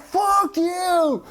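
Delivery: high mock yell while chasing; mock angry yell. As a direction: imitate a friend yelling after a truck. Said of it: "He's like".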